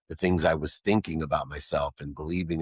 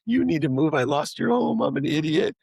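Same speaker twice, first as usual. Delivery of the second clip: higher, louder pleading; distraught confession; 10 semitones higher, 4 dB louder than usual. Retold or imitated. Son confessing to his mother he lost her home, distraught and higher. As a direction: blurt it out falling apart.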